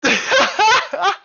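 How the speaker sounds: loud, high burst of giggling; high laughing fit. Real, not acted. Streamer cracking up at Asuna's joke, giggling. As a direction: crack up helplessly.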